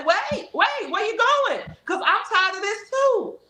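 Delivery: imitating a caught-off-guard voice, high and anxious; panicked pleading. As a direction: call after them as they leave. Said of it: "They'll say".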